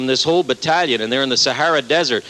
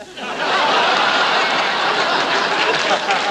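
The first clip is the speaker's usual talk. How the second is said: breathless high laugh at his own punchline; real laugh; 13 semitones higher than usual. Real, not acted. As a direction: crack up at the joke. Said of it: "laughs at own punchline".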